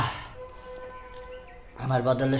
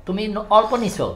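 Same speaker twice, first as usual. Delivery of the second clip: excited teasing; 8 semitones higher, 7 dB louder than usual. Real, not acted. Teasing over small portion.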